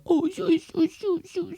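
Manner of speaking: very high, fading soft cooing at a puppy; puppy talk. Retold or imitated. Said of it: "Like, when you see a puppy".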